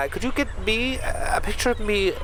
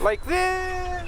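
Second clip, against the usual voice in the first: much higher, innocent query; rising question; 11 semitones higher than usual. Acted. Presenter stepping back toward the edge, pitched much higher. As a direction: ask it sweetly, unaware.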